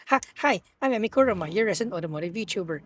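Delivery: usual speaking voice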